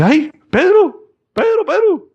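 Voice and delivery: very high, drawn-out squeal of his name; overjoyed servant girl. Acted. Servant girl Rode at the door, squealing slowly with joy. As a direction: swoon over his name, slow and high.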